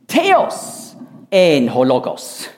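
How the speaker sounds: "Theos en ho Logos" read slowly and deliberately; slow Greek reading. Shown as a demonstration. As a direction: spell out the Greek, word by word. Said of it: "wird sogar noch vorgestellt".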